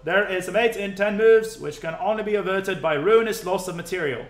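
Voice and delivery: hammed-up old British newsreel announcer; old newsreel British accent. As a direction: read it like a vintage British newsreel. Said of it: "I should probably read it in that old newsreel British accent".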